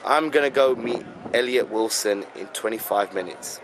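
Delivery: English accent, calm. Shown as a demonstration. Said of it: "let's do some English rap".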